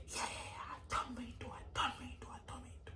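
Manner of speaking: breathy whispered mock rap, almost soundless; whispered hype. Mimics the girl hyping to [name], whispered.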